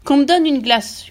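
loud, high, drawn-out demand; hurlement du père. A narrator's reading. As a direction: slowly yell the order. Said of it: "hurla le père". (hurlement impérieux, lent)

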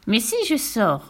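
ordinary delivery

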